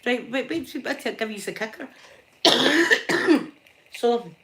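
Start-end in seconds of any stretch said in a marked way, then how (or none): 2.4-3.5 harsh loud coughing fit; a rough, loud real cough. Real, not acted. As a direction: cough hard mid-story.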